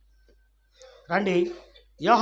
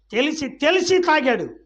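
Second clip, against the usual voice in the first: loud, high insistent cry; raised, repeated hammering of the point; emphatic preaching; 10 semitones higher, 8 dB louder than usual. Real, not acted. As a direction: drive it home at full voice. Preacher drives the point home.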